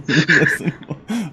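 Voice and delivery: slow, laughing repeat of the word; amused, drawn-out echo. Real, not acted. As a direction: savor it through a laugh.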